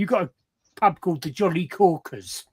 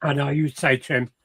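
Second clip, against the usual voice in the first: normal talking voice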